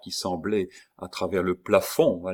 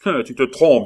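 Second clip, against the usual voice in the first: loud and higher, defiant; defiant shout; 6 semitones higher, 11 dB louder than usual. Acted. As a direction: throw it back at him. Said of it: "dit Bussy". (réplique fière et forte)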